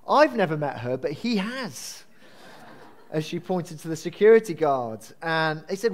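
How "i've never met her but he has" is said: usual speaking voice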